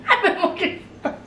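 high, fast giggly quip; laughing. Real, not acted. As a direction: giggle out the joke. Joking reply while laughing.

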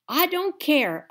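high, loud, slow holler. A narrator's reading. The narrator hollers at her father, loud. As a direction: yell it in distress. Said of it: "I hollered".

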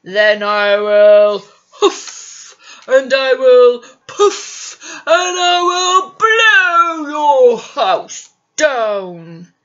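loud slow menacing wolf. Acted. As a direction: huff it out like a wolf. Wolf threatens pigs.

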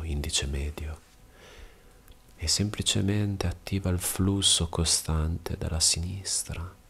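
calm yoga instructor guiding breath, with long pauses; measured bedtime breathing guidance, unhurried pauses between phrases; guided meditation. Real, not acted. Yoga teacher preparing listener for restful sleep, guided meditation.

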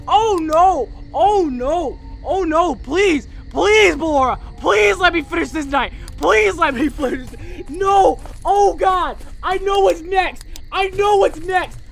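high, loud wailing plea; panicked pleading. Real, not acted. Pleading with Ballora under attack.

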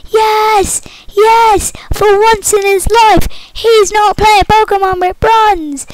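high, cheering fan voice; excited viewer voice. Pretend. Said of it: "I bet you're thinking".

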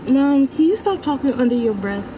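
normal talking voice

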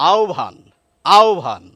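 আহ্বান said aobhan, the h and b swapped; teacher models আহ্বান as aobhan; special pronunciation. Shown as a demonstration. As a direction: stress the swap, আহ্বান as aobhan. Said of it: "উচ্চারণে কিন্তু আমাদের বলতে হবে".

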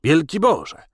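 high, loud exclamation; yell. A narrator's reading. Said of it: "wrzasnął Henry".

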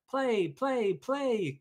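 high, loud eager gosling; a bouncy gosling pleading to play; excited baby goose. A narrator's reading. Excited gosling pesters Jack, high and loud. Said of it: "cried the excited baby goose".

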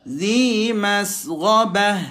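usual speaking voice

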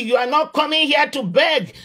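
ordinary talk

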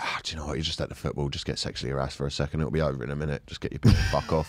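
low, quiet inner monologue; resigned self-talk. Retold or imitated. Man talking himself round at the football, resigned and quiet. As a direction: talk yourself down in a low, soft voice.

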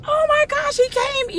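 high-pitched joyful gasp of greeting; thrilled, high-pitched welcome; delighted surprise. Retold or imitated. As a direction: greet a long-missed friend, voice jumping way up. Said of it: "he was so excited".

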